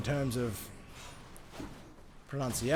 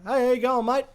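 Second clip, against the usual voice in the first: higher, louder lilt landing on "mate"; cadenced mate greeting; 8 semitones higher, 6 dB louder than usual. Acted. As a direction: call it out with "mate" lifted at the end. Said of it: "with a fucking cadence in mate".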